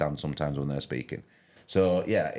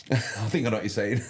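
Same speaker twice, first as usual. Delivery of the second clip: laughing through the words, pitched up; 6 semitones higher than usual. Real, not acted. Streamer laughs while answering a viewer's chat comment.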